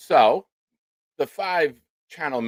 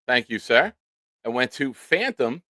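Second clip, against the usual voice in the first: usual speaking voice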